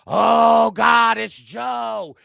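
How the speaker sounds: mock alarmed shout. Other tribe seeing Joe, mock panicked shout. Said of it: "the other tribe is like".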